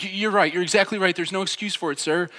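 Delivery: high, stammering over-eager agreement; fearful. Retold or imitated. Driver conceding to the police officer, fearfully deferential. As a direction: imitate a nervous driver giving in to authority. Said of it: "so struck with fear".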